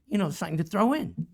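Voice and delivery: ordinary delivery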